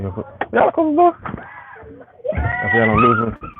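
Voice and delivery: high, slow call to someone in another room. Real, not acted. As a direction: shout it off-camera to the people at home. Calling out to others off-camera.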